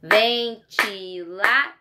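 Ventilador very slow, syllable by syllable, rising at the end; slow teacher's Ventilador, syllable by syllable; split into syllables. Shown as a demonstration. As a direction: split Ventilador apart for the kids. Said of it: "Da mesma forma".

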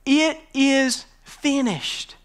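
drawn out slowly, each word weighed; slow and deliberate. Shown as a demonstration. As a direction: stretch it out solemnly. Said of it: "they wrote on it".

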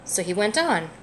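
gentle, lively picture-book read-aloud; reading to a child. A narrator's reading. Parent reading a picture book to a child, gently lively. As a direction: read it warmly for a little one.

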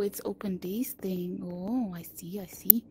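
hushed whisper while sneaking. Real, not acted. Player whispering so the ghost won't hear. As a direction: breathe the words, scared of being heard.

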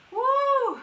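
high, loud whoop; excited whoop. Real, not acted. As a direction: whoop to fire them up. Coach cheering mid workout.

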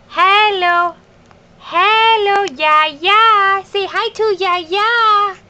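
put-on high baby-talk hello; cooing hello, drawn out; high sing-song greeting. Greeting grandma on video.

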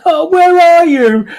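high, slow panicked cry. A narrator's reading. Sergeant hunting the vanished socks, panicked cry. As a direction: search frantically. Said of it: "His voice was panic-fueled now".

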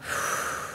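long, soft exhale; imitated deep breath. Client obeying masseuse's breathing cue, acted slow exhale. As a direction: breathe out slowly, acting out relaxing. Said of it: "So I was like".

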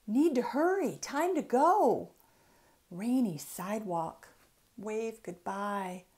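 plain reading voice

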